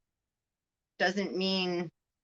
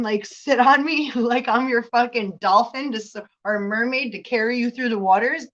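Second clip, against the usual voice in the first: usual speaking voice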